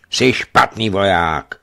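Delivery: loud, slow, severe reproach; loud slow stern scolding; stern rebuke. Acted. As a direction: scold the messenger. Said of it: "pokračoval bojar stroze".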